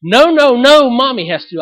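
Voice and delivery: put-on a toddler refusing her father; whiny toddler protest. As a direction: protest like a cranky two-year-old. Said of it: "toddler protest".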